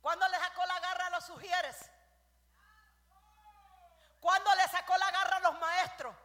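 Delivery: very loud, high accusing cry; full-force preaching shout. Real, not acted. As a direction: blast the questions out. Preacher rebukes gossiping congregation.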